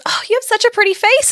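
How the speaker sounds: high sweet compliment; sweet lady voice. Retold or imitated. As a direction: gush like a kind lady. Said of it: "And she goes".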